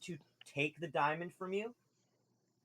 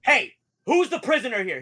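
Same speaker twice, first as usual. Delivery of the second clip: loud, raised challenge; suddenly aggressive; 8 semitones higher, 13 dB louder than usual. Acted. Drow guard turning on Wendelin, aggressive shout. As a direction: turn on the prisoner sharply and loudly. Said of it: "He gets aggressive really fast".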